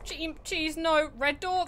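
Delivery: ordinary talk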